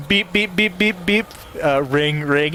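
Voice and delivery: playful phone beeps; imitating a dial tone. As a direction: mimic dial-up noises.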